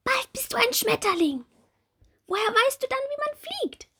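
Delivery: high, bright little bumblebee; small bumblebee voice. Acted. Little bumblebee Bommel asking Marie about her wings, high childlike voice. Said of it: "Bommel sagt hastig".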